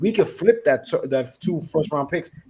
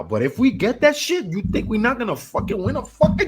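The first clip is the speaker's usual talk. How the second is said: excited; 10 semitones higher, 5 dB louder than usual. Real, not acted. Hyped over the dream trade.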